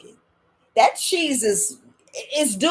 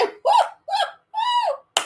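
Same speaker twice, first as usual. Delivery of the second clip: much higher hammed-up woo sounds; mock wail; slower than usual. Grandma acting out her happy wailing, much higher. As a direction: wail it in fake joy. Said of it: "I was like".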